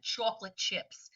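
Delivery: put-on slow, a light New York accent on chocolate chips. Teacher repeating the phrase in a light New York accent. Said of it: "with the New York accent".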